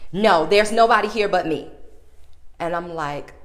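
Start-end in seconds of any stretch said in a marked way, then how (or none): 0.1-1.7 curt clerk. Retold or imitated. Clerk refusing manager.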